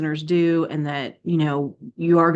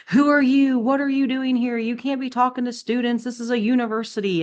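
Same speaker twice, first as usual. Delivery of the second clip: high-pitched mock outraged lady; irate lady; 8 semitones higher than usual. Staff lady confronting a preacher on campus, mock indignant. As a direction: imitate an indignant lady challenging a stranger, pitched up. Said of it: "this lady come running out".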